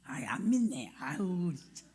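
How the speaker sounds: breathy, toneless aside; a quick hushed tease at the doubters; whispered aside. Real, not acted. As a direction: whisper it to the audience, half teasing.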